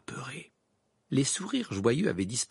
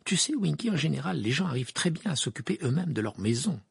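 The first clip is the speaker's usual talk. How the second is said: put-on higher-pitched, lecturing girl; stern; 8 semitones higher, 3 dB louder than usual. A narrator's reading. Hermione lectures Winky on self-reliance, stern. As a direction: set the elf straight, pitched up. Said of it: "d'un air sévère".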